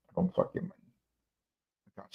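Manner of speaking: a whispered aside to himself. Real, not acted. Whispering to the camera that he doesn't mind the glasses.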